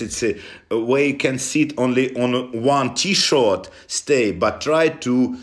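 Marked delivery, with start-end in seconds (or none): none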